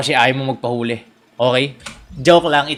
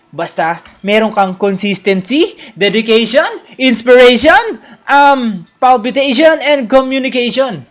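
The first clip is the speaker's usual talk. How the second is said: rising, louder hype list of virtues; motivational speaker; 5 semitones higher, 5 dB louder, slower than usual. Pretend. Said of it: "Motivational speakering". (parang motivational speaker)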